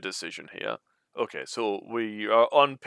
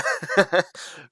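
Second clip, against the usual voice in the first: breathy laugh; 8 semitones higher, 3 dB louder than usual. Real, not acted. Laughs at own complaint.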